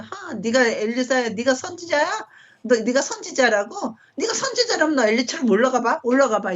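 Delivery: high sneering taunt, laughing through it; mocking taunt. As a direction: jeer at the prophet with a scornful laugh. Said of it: "이러고 놀리는 장면이라는 거야". (비웃으며 높은 목소리로 놀리듯)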